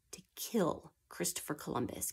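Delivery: whispered, dropping low; hushed whisper. A narrator's reading. Teacher telling children of the mutiny plot, whispering. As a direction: hush it like a dark secret.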